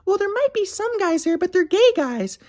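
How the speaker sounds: high falsetto excuse; airy high voice, brushing it off; mocking his ex. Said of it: "so she was like".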